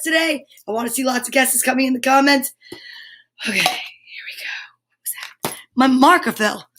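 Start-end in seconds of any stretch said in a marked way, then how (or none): 3.5-4.8 whisper. Real, not acted. Whispered start of reveal. 4.8-5.6 whisper. Real, not acted. Startled whisper at noise.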